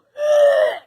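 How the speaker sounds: high, loud retching squeal; loud mock gagging. Retelling gagging while driving, loud high mock retch. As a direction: retch loudly, hammed-up. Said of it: "I started gagging again".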